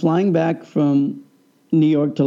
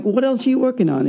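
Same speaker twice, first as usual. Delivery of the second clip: excited; 9 semitones higher, faster than usual. Retold or imitated. Eager question to stadium builder.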